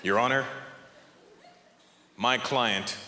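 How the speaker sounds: low, grave lawyer addressing the judge; serious courtroom lawyer. Acted. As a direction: plead solemnly, leaving long dramatic pauses. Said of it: "Picture a serious courtroom drama".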